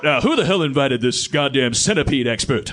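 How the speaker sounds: loud gruff indignant bellow; Keith David impression. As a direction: boom it like a fed-up tough guy. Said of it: "This is my Keith David impression".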